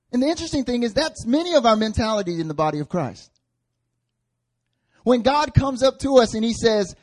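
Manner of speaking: loud, high, driving sermon delivery; forceful preaching. Real, not acted. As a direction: press each phrase home to the congregation.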